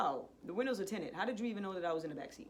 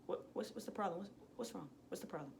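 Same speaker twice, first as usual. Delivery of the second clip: very soft, cool questioning; quiet, unruffled challenge; hella calm; 10 dB quieter than usual. Retold or imitated. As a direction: keep a confrontation hushed and composed. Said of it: "hella calm".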